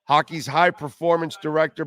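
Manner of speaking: ordinary talk, nothing marked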